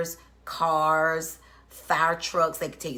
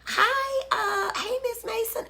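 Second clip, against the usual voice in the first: high bright hello to viewers; excited greeting. Real, not acted. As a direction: greet them with a big lift.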